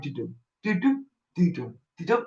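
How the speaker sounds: soft imitated end-stream tune; quiet hummed do-do jingle. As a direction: hum the waiting jingle quietly.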